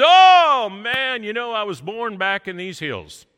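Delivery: big hearty storyteller boom; loud booming man. Retold or imitated. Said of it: "he speaks very loudly".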